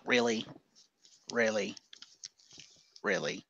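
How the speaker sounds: low, hushed, unimpressed repeats; quietly incredulous, letting each one hang; deadpan disbelief. Pretend. Imagined wrestler watching backstage in dismay, deadpan and very quiet. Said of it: "just Finn be like".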